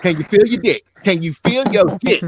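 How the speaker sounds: loud, high, playful question; loud teasing question. Retold or imitated. Teasing his injured best friend in the hospital, loud and playful. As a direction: call it out loud to rib him.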